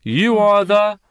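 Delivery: loud emphatic recital. Acted. Preacher prompting the congregation.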